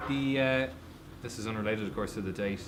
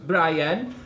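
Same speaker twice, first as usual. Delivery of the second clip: Welsh accent; 6 semitones higher, 6 dB louder than usual. Shown as a demonstration. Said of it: "with a Welsh accent".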